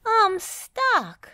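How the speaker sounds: high, loud worried cry; distressed. Acted. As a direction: plead that she's stuck. Dizzy sinking in concrete.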